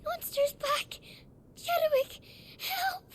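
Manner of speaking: high, terrified call; panicked cry for help. Acted. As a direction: scream for help as it attacks. Girl calling out as monster returns.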